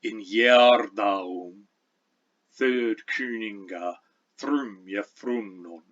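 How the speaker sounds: put-on slow, measured Old English verse with continental vowels, trilled R; stately Old English, every consonant sounded and throaty ch; Old English recitation. Shown as a demonstration. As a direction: recite it like Old English poetry, half-line by half-line. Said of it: "so here's how it sounds".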